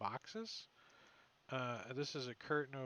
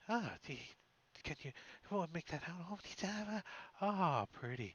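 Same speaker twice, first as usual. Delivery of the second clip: gibbering woman; 4 semitones higher, 4 dB quieter than usual. Retold or imitated. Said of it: "a female's voice all gibbery".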